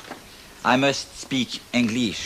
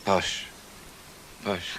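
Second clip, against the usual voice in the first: put-on Posh repeated with a French accent, the second softer; 4 semitones lower, 7 dB quieter than usual. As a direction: try out Posh like a French learner. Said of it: "taught to talk posh".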